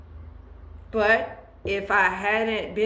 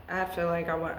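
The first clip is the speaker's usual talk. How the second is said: normal talking voice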